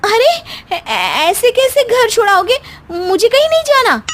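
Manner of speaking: high, alarmed refusal; wife shocked at being sent home; shocked protest. Acted. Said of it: "सास बहू शौक से".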